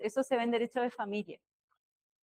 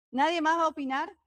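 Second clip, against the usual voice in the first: real, slightly shouted question, pitched higher; raised voice; 5 semitones higher, 4 dB louder than usual. Real, not acted. Teacher asking if anyone else will weigh in, raised voice. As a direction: raise your voice to call on the room. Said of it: "Ay, yo estoy gritando".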